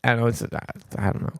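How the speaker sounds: ordinary talk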